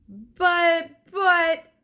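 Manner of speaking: high, loud panicked stammer. Acted. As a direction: stumble over the word in panic. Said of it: "stammered Jack".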